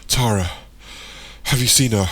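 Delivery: loud, breathless question; catching breath. A narrator's reading. Michael asking Darren after running, out of breath. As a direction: ask it urgently between breaths. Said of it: "catching his breath".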